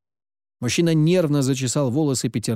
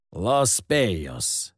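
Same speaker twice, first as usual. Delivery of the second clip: low, slow, rasping with whistling s; 5 semitones lower, much slower than usual. A narrator's reading. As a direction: rasp it slowly with a hissing whistle. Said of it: "совсем низким, хрипящим голосом со странным свистящим звуком".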